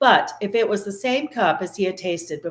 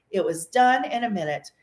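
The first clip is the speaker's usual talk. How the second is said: plain reading voice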